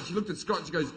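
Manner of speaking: ordinary talk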